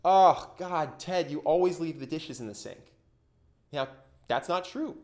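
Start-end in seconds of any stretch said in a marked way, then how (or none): 0.0-2.9 loud, exasperated groan at a roommate; exasperated complaint. Retold or imitated. Roommate griping at Ted about dishes, exasperated. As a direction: blurt the accusation with rising annoyance. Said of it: "what most people will do is go".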